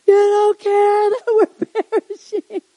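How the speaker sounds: high, loud, slow wail; hammed-up whining complaint to someone who won't help; mock panic. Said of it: "We have all our ways of saying that".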